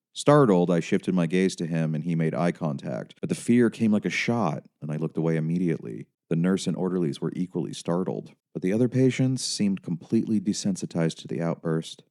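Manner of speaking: usual speaking voice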